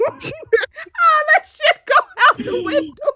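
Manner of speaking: high, slow squeal through laughter; laughing. Real, not acted. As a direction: squeal it out, cracking up. Laughing at mom dropping the act.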